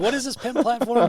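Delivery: mock disbelief. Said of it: "I'm like".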